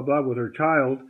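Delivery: ordinary talk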